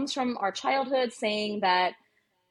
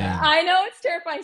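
high, loud I know; emphatic agreement; 8 semitones higher, 7 dB louder than usual. Real, not acted. Pediatrician agreeing with the host, loud and high. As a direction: throw the words up high and loud. Agreeing loudly with host's reaction.

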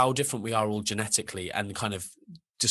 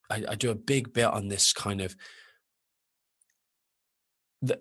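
normal talking voice

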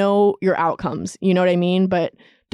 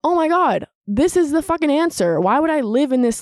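high-pitched, elated retold revelation; retold burst of self-assurance, pitched up; confident realization; 7 semitones higher than usual. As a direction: exclaim it like a sudden breakthrough. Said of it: "I felt so confident".

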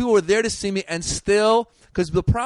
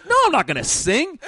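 higher, laughing through the refusal; laughing retort; 9 semitones higher, 3 dB louder than usual. Retold or imitated. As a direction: laugh it off in disbelief. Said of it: "I'm like".

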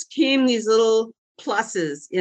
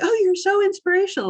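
much higher, gushing mock admirer; gushing fan; 13 semitones higher than usual. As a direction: gush it like an eager fan. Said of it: "It's like".

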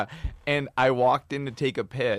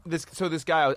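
ordinary talk, nothing marked

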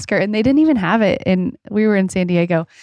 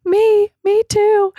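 put-on very high, drawn-out little kid voice; squeaky mock toddler plea; Luke; slower than usual. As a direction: imitate a small child piping up hopefully. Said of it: "Luke was like".

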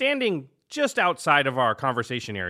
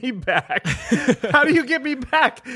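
laughing; 10 semitones higher, 4 dB louder than usual. Real, not acted. Teased friend threatens payback.

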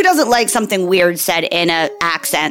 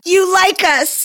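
high, slow mock-sweet coo; mock gushing; 11 semitones higher, slower than usual. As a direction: coo it like a flattered fan. Playful gushing aside.

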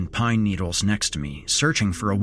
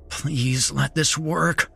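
slow, higher-pitched pleading; desperate plea; 4 semitones higher, slower than usual. A narrator's reading. Narrator pinned, gripping a pine cone, pleading. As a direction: plead under your breath, drawn out.

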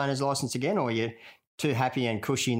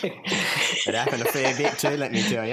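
laughing tease; 3 semitones higher, 4 dB louder than usual. Real, not acted. Teasing rival, laughing.

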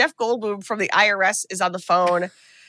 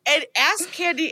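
normal talking voice

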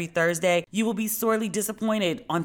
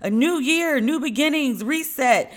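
mock cheerful voice; 10 semitones higher, 4 dB louder than usual. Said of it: "everyone's very much like".

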